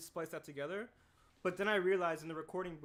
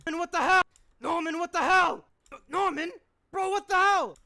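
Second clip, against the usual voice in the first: much higher and louder, outraged shriek; shocked cartoon yell; 14 semitones higher, 14 dB louder than usual. Shown as a demonstration. Voice actor demos a character yelling at Norman. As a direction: scream it like a cartoon character losing it. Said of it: "is there a better one".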